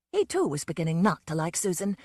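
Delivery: plain reading voice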